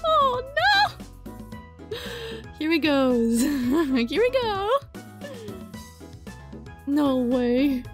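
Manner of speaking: ordinary talk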